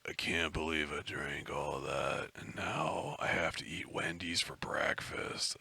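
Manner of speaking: low, soft, slow groggy rasp; hangover voice. Retold or imitated. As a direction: groan it out, hungover. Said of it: "a hangover voice".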